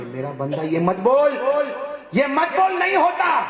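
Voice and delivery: rising to a high shout; angry God. Acted. God angrily rebuking the servant, shouting. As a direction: snap back in anger. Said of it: "اللہ غصے میں کہیں گے".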